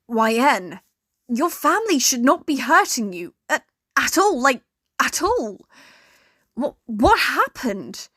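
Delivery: barely holding back rage; furious. A narrator's reading. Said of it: "She was furious as she spoke".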